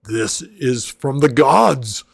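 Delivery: loud, thrilled outburst with a laugh in the middle; amazed half-orc delight. Acted. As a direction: gush over the cocoa like it's divine. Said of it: "he immediately is like".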